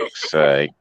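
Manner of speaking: ordinary talk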